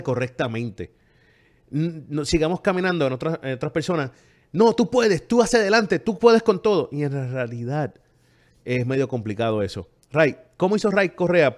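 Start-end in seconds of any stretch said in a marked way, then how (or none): 4.5-6.9 pitched higher, pushy pep-talk voice; a higher, louder hammed-up motivational speaker; mock motivational cheer. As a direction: parody the upbeat "you can do it" coach.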